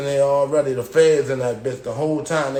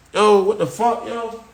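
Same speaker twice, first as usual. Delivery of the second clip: retold shocked outburst; 7 semitones higher than usual. Inmate in a locked cell, shocked yelling. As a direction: yell it like the cell should have opened.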